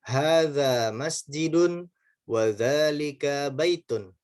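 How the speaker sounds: slow, careful Arabic reading for pupils to repeat; slow Arabic drill read; slow model reading. Shown as a demonstration. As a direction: read it out slowly, each syllable clear. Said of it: "Yuk baca sama-sama".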